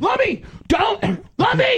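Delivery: high, crying shout; anguished cry. Real, not acted. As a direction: wail his name in real distress. Friend vomiting on camera.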